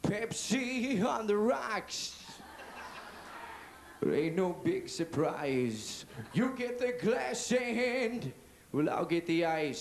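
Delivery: slow, crooning romantic singer. Acted. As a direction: croon it slowly to the ladies. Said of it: "romantic voices".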